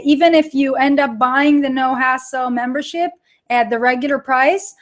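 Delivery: ordinary talk, nothing marked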